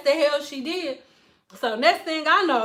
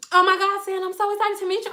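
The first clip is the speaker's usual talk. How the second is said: gushing client; 6 semitones higher, much faster than usual. Retold or imitated. Client gushes on meeting her.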